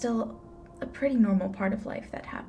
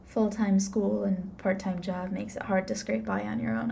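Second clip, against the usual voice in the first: usual speaking voice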